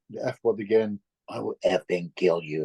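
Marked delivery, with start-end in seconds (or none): 1.3-2.6 lower, imitated threat; a lowered mock snarl of rage; retelling the spirit's rage in a lowered voice; mock wild threat. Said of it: "And he went wild".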